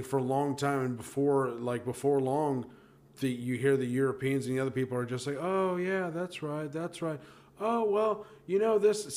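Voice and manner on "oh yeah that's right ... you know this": high, light mock-polite listener; meek interjecting. European dinner guest trying to get in edgewise, meek interjecting. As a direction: slip in small agreeable remarks, pitched up. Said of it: "Somebody tries to get in something edgewise".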